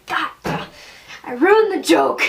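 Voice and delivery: loud, laughing self-scolding; a sudden shout at her own failed joke; exasperated exclamation. Real, not acted.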